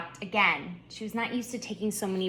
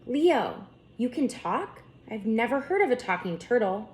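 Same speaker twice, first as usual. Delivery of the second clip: shocked; 5 semitones higher than usual. A narrator's reading. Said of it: "shocked by what she just heard".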